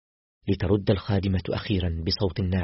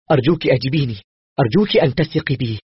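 higher, louder frightened begging; desperate, pleading for trust; panicked plea; 5 semitones higher, 7 dB louder than usual. A narrator's reading. Doctor to Aseel at the intercom, panicked pleading. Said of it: "فسألتها بذعر".

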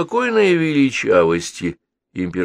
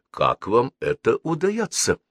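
courteous question, raised pitch; polite question; 8 semitones higher than usual. A narrator's reading. Джон Грей asking the boy his secret, politely. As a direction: ask with polite curiosity. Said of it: "вежливо спросил Геннадия".

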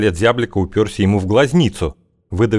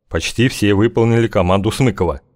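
plain reading voice

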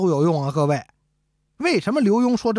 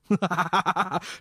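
big-bellied laugh. Acted. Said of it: "舔着大肚子乐了". (王爷捧着大肚子憨笑)

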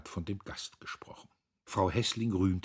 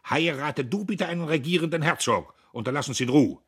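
loud, high angry command; imperious rebuke; 9 semitones higher, 11 dB louder, faster than usual. A narrator's reading. Brother rebukes sister at table, imperious and loud. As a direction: berate her with heavy sarcasm. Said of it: "Er herrschte Emmy an".

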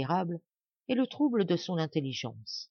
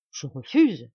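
louder, firm refusal; defiant refusal; 3 semitones lower, 8 dB louder, slower than usual. A narrator's reading. Dying Marianne refusing Madame Blanche, defiant and louder. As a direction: refuse flatly.

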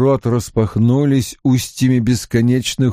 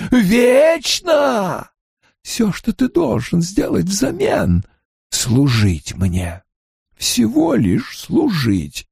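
dark lord; 6 semitones higher than usual. Acted. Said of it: "настойчивый голос".

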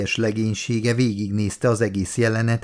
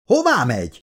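higher demanding call; mild shout, pitched up; calling after someone; 4 semitones higher than usual. A narrator's reading. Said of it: "kiáltott rá Shaw doktor".

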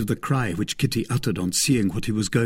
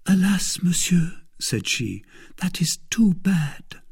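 sad whisper; 8 semitones higher than usual. Acted. Kitty laments, second sigh.

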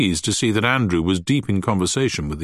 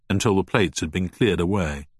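plain reading voice

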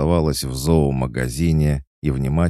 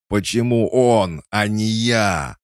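high, drawn-out jealous wail; anguished wail; 10 semitones higher, 6 dB louder, slower than usual. A narrator's reading. Andrei, jealous of his friend, wailing. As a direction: cry it out slowly, high and loud. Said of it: "завопив при этом".

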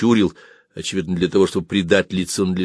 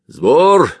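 loud, raised one-word order; commanding call; 8 semitones higher, 7 dB louder than usual. Acted. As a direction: call the men to muster. Commander calls the muster. (громкая команда)

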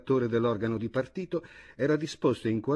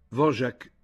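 plain reading voice

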